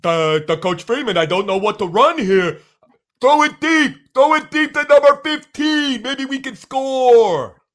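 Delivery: loud, high, dim-witted caveman grunting. Retold or imitated. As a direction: bellow it like a clueless caveman. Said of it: "Caveman voice for Tommy Rees' play calling".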